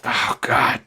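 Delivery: whispered dismay. Retold or imitated. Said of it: "and I was like".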